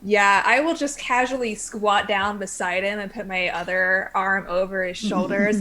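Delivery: ordinary talk, nothing marked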